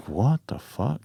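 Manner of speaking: retold under-the-breath bewilderment; hushed disbelief. Hunter to himself in his tent, hushed disbelief.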